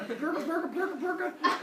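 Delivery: high, laughing blurka blurka; stuffing noises. Acted. Parents shoving coins into the girl's mouth, laughing gibberish. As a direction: giggle the coin-shoving noises.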